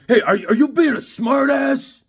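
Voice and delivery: high, loud, affronted challenge; imitated angry neighbor. Imitating neighbor challenging the sarcastic dog walker, angry. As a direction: act out an irate neighbor taking offense. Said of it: "This guy's angry".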